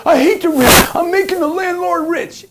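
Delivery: high, loud mocking complaint; mocking whiny renter. Mocking renters who complain about paying rent, in a high whiny voice.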